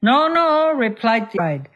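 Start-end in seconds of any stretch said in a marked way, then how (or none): 0.0-0.7 defiant refusal. Acted. Friend refuses, acted quarrel.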